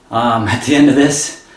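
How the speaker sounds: usual speaking voice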